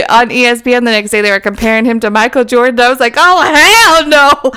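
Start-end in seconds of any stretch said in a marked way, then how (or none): 3.2-4.5 slow, laughing hell no; mock outrage. A fan watching ESPN, slow laughing mock outrage. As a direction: drag it out in fake outrage. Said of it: "I got all mad".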